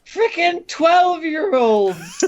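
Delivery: high, slow words through laughter; laughing. Real, not acted. Friend laughing at kids' anime drama, slow laughing squeal. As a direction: laugh while getting it out.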